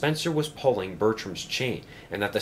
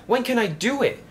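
very high, eager question; thrilled little engine, pitch way up; excited; 14 semitones higher, 3 dB louder than usual. A narrator's reading. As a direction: pipe up excitedly. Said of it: "said Bertram excitedly".

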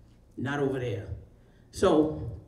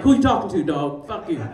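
tough attitude; 4 dB louder than usual. Retold or imitated. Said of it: "Now I got an attitude".

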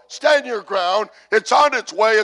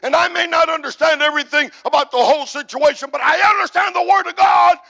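high, driving, impassioned proclamation; defiant preaching declaration; 8 semitones higher, faster than usual. Acted. Preacher voicing the steadfast believer, defiant declaration. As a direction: declare it as someone who will not budge.

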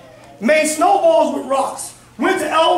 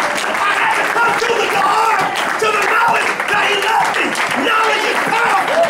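full-force preaching; 6 semitones higher than usual. Acted.

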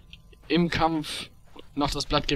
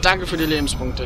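ordinary talk

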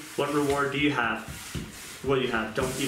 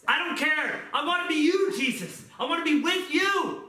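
high, pleading prayer voice, pouring it all out; fervent prayer; 10 semitones higher than usual. Acted. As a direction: cry out to God in surrender. Impassioned prayer to Jesus.

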